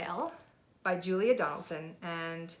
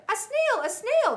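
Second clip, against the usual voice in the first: much higher, louder alarmed squeal; startled teacher crying out; teacher spotting a snail on the board; shocked shriek; 10 dB louder, slower than usual. Acted. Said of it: "the teachers turned pale".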